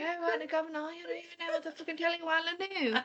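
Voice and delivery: high, fast mock British rant; hammed-up British patter; British accent. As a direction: rattle it off in a put-on British accent. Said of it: "talking in a British accent".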